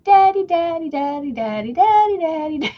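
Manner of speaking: put-on high, loud, building baby babble; baby babbling. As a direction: babble excitedly like the baby dinosaur. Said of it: "baby starts going".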